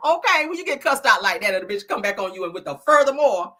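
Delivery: ordinary talk, nothing marked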